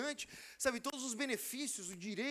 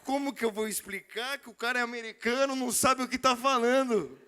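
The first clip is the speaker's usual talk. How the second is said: louder, slowed, laughing through it; laughing panic; 8 dB louder than usual. Retold or imitated. Wondering how to explain the American's mistake, laughing. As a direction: laugh at your own predicament.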